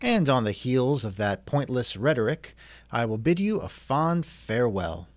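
ordinary delivery

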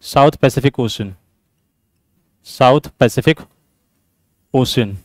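low, soft, word-by-word dictation; slow dictation. Real, not acted. Teacher reading out a quiz statement for students, slow dictation. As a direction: dictate slowly with long gaps between words.

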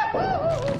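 high yelled come on; frustrated shout. Real, not acted. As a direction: yell it at the screen. Waiting for game, shout.

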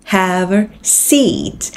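"Have a seat" stretched out very slowly. Shown as a demonstration. Teacher slows right down on the key phrase. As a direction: draw each word out for learners. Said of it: "để ý giùm [name]".

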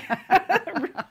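high burst of laughter. Real, not acted. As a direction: laugh out loud. Laughing at the circus name.

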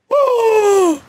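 high, drawn-out gasp; sharp gasp. Real, not acted. Viewer reacting to the game video, startled gasp. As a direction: gasp high in shock at the screen.

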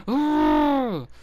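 loud high frustrated groan; loud exasperated groan. Real, not acted. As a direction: let out an exasperated ugh.

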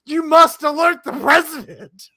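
high, loud imitation of urgent warning; mock alarmed shout. Imitating the reply guy warning Joe Rogan, shouting high. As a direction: shout it like a hammed-up alert. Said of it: "And he's like".

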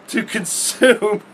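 slow, laughing disbelief; can't hold back the laughs at how absurd it is; laughing through it. Real, not acted. Telling viewers about junk food, laughing slowly.

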